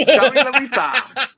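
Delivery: high, loud hammed-up Japanese accent. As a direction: ham up a Japanese accent, laughing. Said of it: "said in a Japanese accent".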